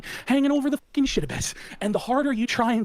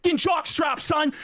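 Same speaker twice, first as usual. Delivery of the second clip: pitched-up insult; 8 semitones higher than usual. Acted.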